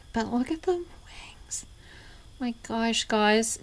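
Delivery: hushed, breathy wonder at the glass wings; awed whisper. Real, not acted. Showing viewers a fragile ornament, in an awed whisper. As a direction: whisper it like a secret treasure.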